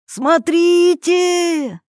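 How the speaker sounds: high, loud, long-drawn yell; joyful shout. A narrator's reading. Yuri pointing out the road, joyful shout. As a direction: shout it out, stretching the vowel. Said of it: "закричал он, радостно показывая рукой".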